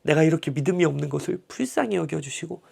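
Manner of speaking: soft, hushed plea of faith; humble whispered prayer. Retold or imitated. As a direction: pray it under your breath, humbly. (믿음 없음을 고백하는 아버지의 속삭이는 기도)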